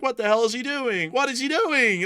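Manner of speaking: high, loud, incredulous; can't believe what he's doing; high-pitched exasperated rant; exasperated disbelief. Retold or imitated. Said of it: "now I'm like".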